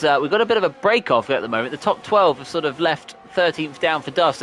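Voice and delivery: usual speaking voice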